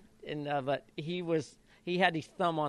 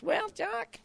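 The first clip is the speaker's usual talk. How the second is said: much higher imitation of the trainer's squeaky voice; high-pitched chief. Jockey mimicking his trainer before the race, much higher squeaky voice. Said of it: "he had that kinda high-pitched voice".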